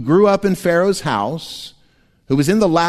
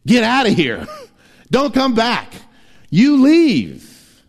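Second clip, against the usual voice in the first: rising, louder mock order to leave; mock expulsion; 10 semitones higher, 4 dB louder, slower than usual. Pharaoh driving the Hebrews out, shouted. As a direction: throw them out like an angry king.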